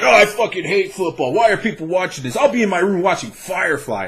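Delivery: mock nerd whining about football. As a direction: sneer it like an offended nerd online. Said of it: "that they're like".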